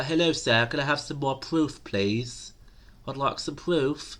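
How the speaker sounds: high, singled-out mock begging, slowing at the end; mock polite plea. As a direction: beg with exaggerated politeness. Mock polite request for proof.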